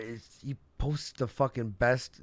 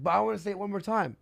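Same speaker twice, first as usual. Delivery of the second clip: insistent; 7 semitones higher, faster than usual. Retold or imitated. Acted quarrel, streamer insisting.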